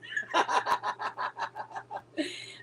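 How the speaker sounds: long, high wheezy laugh; helpless drawn-out laughing fit. Real, not acted. Laughing at someone's remark.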